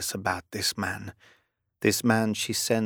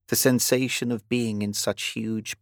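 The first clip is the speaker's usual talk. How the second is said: ordinary delivery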